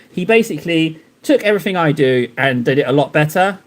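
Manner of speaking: ordinary delivery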